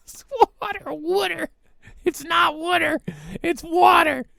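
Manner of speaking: Philadelphia "wooder", pitched high; imitating a Philly friend saying water as wooder; Philly accent. Imitating a Philly friend, high-pitched Philadelphia accent. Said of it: "my friend from Philly".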